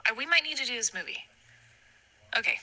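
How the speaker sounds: normal talking voice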